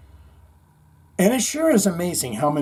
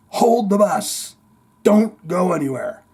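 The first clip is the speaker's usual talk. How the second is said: louder and higher, a firm order to the driver; urgent command; 5 semitones higher, 4 dB louder than usual. Retold or imitated. Man telling the bus driver to wait, loud urgent command. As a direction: stop the driver from pulling away.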